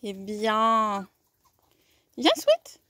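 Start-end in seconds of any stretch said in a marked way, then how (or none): 2.2-2.8 high, loud call to the dog. Real, not acted. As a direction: call her in, bright and loud. Calling the dog.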